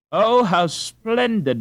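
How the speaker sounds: high, loud, slow cry of wonder; the whole crowd marvelling aloud; delighted echo. Acted. Crowd echoing Gretchen's joy, high and loud. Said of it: "is echoed as far as people can see".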